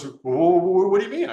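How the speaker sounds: high, loud stuttering disbelief; mock flustered stammer. Head of food and beverage hearing the crab plan, high stammering shock. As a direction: trip over the first word in shock.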